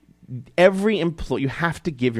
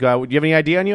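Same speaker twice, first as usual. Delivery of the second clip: higher, louder mock cop demand; mock cop asking for ID; imitating cop; 6 semitones higher, 6 dB louder, much faster than usual. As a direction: play the officer as a pushy, loud questioner. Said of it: "he's like".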